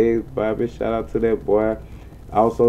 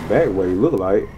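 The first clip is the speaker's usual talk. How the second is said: normal talking voice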